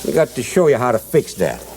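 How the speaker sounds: usual speaking voice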